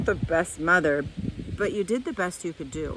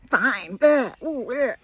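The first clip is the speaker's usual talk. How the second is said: higher, louder bratty bleh noises; mocking the defensive reaction; mock dismissive; 4 semitones higher, 4 dB louder, slower than usual. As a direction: brush it off like a sulky kid. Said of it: "our initial response is to be like".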